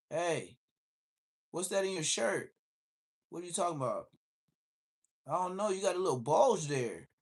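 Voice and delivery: suspicious cop, pitched high; probing, mock-friendly questioning; officer eyeing the teen's shirt, suspicious; imitating the cop. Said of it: "he'd be like".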